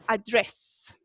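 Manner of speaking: "address" as an Americanized Andrés, a-DRESS; gringo accent. Shown as a demonstration. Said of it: "de una forma un poquito gringa".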